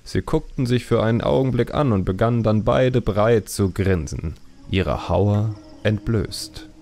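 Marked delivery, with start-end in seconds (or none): none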